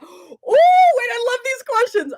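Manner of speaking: high, slow, delighted gasp into the words; excited gasp. Real, not acted. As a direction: gasp with real delight and draw it out. Delighted by the question.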